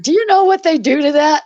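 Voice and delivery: doctor yelling at his staff about corn; retold yelling. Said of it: "like, yelling".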